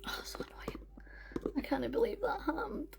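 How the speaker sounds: very soft, whispered grumble to yourself; hushed, real annoyance under the breath; frustrated whisper. Real, not acted. Still upset about fallen phone.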